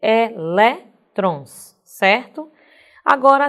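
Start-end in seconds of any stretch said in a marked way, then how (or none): 0.0-1.8 "Elétrons" very slowly, syllable by syllable; stress on LÉ. Shown as a demonstration. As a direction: break it into e-LÉ-trons, leaning on LÉ. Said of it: "vai ser acentuado lé". ("Elétrons" bem silabado, com força no LÉ)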